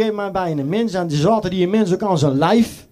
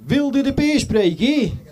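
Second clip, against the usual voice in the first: "Wilde de peer spreken?" said slowly and higher, prim and polite; phone lady; 7 semitones higher, slower than usual. Retold or imitated. Doorman imitating the phone receptionist, slow, lightly higher. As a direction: mimic the switchboard woman, slow and a bit higher. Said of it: "zegt ze".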